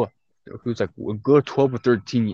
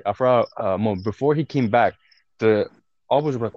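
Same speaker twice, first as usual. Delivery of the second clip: ordinary talk, nothing marked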